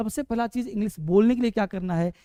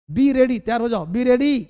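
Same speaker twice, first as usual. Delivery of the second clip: loud rallying call to get ready; urgent command; 7 dB louder than usual. Shown as a demonstration. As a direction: call it out loudly, like rousing a class. (ज़ोर से आदेश देते हुए)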